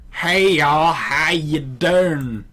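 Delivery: broad Southern drawl, slow and loud; Southern accent. Shown as a demonstration. Friend showing how locals sound in a Southern accent. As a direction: ham up a thick Southern greeting. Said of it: "really Southern people, like, here, I can hear".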